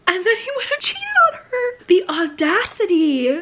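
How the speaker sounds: high, half-crying outrage; outraged disbelief. Real, not acted. To viewers about his cheating, high outraged wail. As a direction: wail it in mock despair.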